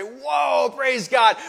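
high, loud worship shout; exuberant praise. Retold or imitated. Worshipper caught up in the singing, exuberant praise. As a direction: call out praise, loud and lifted. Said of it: "we'll really get singing".

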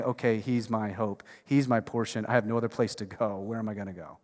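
soft, low voice of someone at the end of their rope; hushed desperate plea. Retold or imitated. A desperate believer turning to God, hushed plea. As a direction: let it sink almost to nothing, pleading.

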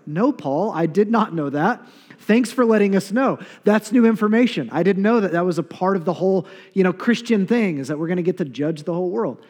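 hammed-up mock gratitude for the news; mock sarcastic reply. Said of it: "most of us in the room are like".

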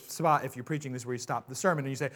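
normal talking voice

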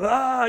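loud, high exasperated ah; exasperated cry. Retold or imitated. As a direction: vent frustration at the damaged car. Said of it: "marching around and looking at it".

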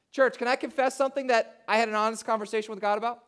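ordinary talk, nothing marked

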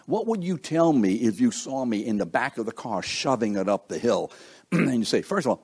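ordinary talk, nothing marked

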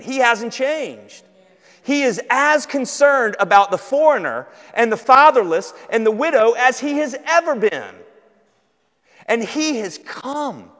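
loud, high proclamation; impassioned preaching. Real, not acted. Preacher assuring the church God has not changed, impassioned. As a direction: proclaim it loudly, driving each key word.

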